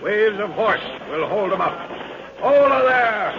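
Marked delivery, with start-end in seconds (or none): 2.4-3.3 loud, high battle cry; rallying shout. Acted. Commander shouting to launch the horsemen. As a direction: send the riders off with a yell.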